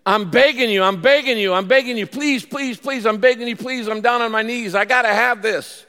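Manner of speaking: high, insistent pleading, repeated urgently; earnest begging. Acted. Preacher shows what pleading with God is, earnestly begging. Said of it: "it's earnestly begging".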